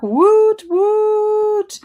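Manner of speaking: high, loud woot woot; excited cheer. Real, not acted. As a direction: cheer it out like a party. Cheering a viewer home from work.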